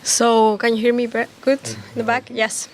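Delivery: raspy voice with a cold; hoarse, strained voice from a cold. Real, not acted. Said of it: "I have a cold".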